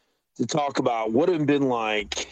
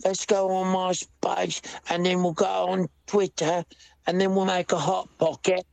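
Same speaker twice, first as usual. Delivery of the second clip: put-on higher working-class English accent; common English accent; 8 semitones higher than usual. Peasant planning his day, higher English accent. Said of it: "There we go".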